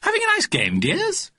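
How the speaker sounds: very high, cooing mother's voice; high-pitched motherly enquiry; fussy mother. Acted. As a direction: sing out sweetly to the children, way up high. Mother calls to playing boys.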